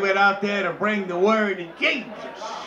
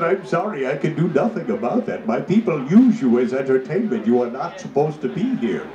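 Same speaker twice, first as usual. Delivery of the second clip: low, booming chief pronouncing a sentence; grave verdict from a huge chief, very low; deep chief voice; 10 semitones lower than usual. Acted. Chief offering the captives death or Kutanga, very low.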